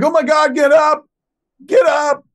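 high, loud panicked waking call; imitating a hysterical girlfriend. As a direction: shriek him awake in a panic. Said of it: "a hysterical Jennifer Schwalbach".

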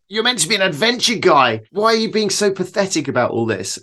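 self-scolding. Retold or imitated. Adventurer mocking his own fear, scolding. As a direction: tell yourself off, half-joking. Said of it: "beat myself up".

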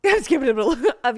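laughing at own slip of the tongue; amused, breaking into laughter. Real, not acted. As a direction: giggle through the words. Laughing at her own mispronounced word.

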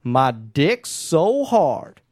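slow, low rap-lyric delivery; mock rapper drawl. Quoting rapper's lyric slowly.

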